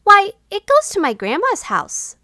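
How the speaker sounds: put-on high, bright child's voice; little girl voice. A narrator's reading. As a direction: chirp it like a young girl.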